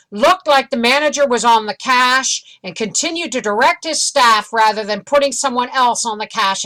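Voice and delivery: high, loud, punchy reading of complaints; review read-aloud. Acted. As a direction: announce each grievance from the review. Reads online reviews aloud.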